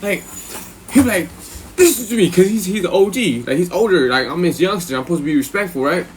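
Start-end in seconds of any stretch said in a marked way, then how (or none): 1.8-2.3 high-pitched mock anger; mad elder cellmate. Older cellmate to his youngster, high mock anger. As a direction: imitate an angry demand, pitched well up. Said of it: "mad as fuck".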